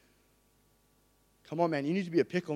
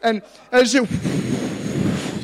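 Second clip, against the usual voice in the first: loud, higher, joking grumble; Peter's mock complaint; 5 semitones higher, 10 dB louder, slower than usual. Preacher playing Peter, loudly grumbling about a draft. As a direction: call it out like a puzzled disciple. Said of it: "Peter's like".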